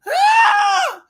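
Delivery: very high, loud shriek of excitement; piercing thrilled scream; excited scream. Real, not acted. Excited scream over friend's good news.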